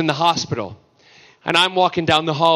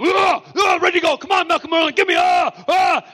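hammed-up battle cry, much higher; pumped-up mock shout; 13 semitones higher than usual. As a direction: act a chained actor psyched for the scene. Voicing Steven pumped up, chained.

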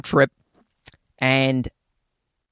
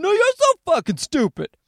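much higher, mock whiny yelled comeback; mock retort from the other side; 12 semitones higher than usual. The opposing side firing the insult back, mock angry. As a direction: throw the insult right back, hammed-up. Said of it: "on the other side going".

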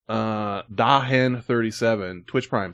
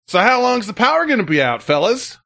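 higher-pitched retold swagger; breezily sure of yourself, pitched up; cocky; 9 semitones higher than usual. Homeowner asking the crew about the power, cocky. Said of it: "all cocky".

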